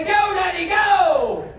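high, loud mock child cheer; hammed-up little kid yelling encouragement; kid cheering dad. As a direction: play the excited kid egging daddy on.

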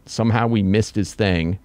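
ordinary talk, nothing marked

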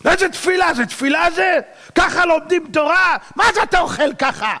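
high-pitched volley of scolding questions; shouted self-rebuke. Acted. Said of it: "הוא צועק על עצמו". (נזיפה עצמית בצעקות)

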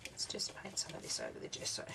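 breathy whispered aside to herself; whispering. Real, not acted. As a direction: whisper it as you paint. Quiet aside while painting.

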